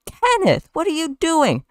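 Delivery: very high-pitched scolding woman; squeaky falsetto reprimand; shocked interruption. Acted. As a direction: burst in, aghast, way up high. Vanessa bursts in and interrupts.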